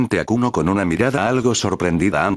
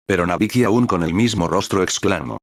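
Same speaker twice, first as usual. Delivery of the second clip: ordinary delivery, nothing marked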